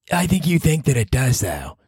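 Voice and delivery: put-on girlfriend voice. Girlfriend voice continues.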